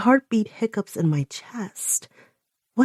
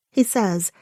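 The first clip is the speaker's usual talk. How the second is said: ordinary delivery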